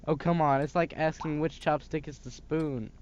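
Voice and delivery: ordinary talk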